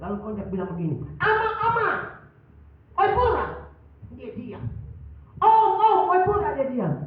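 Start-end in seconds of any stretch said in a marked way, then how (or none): 1.2-3.5 calling out. Retold or imitated. Bus conductor calls out. 5.4-6.5 calling out. Retold or imitated. Conductor calls again.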